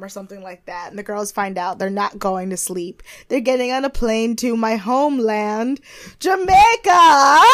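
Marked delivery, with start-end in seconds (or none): 6.2-7.4 drawn-out, high, loud cheer; excited announcement. Real, not acted. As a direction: belt it out slowly like a proud hype call. Hyping her homeland.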